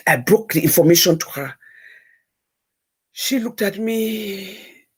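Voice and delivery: ordinary talk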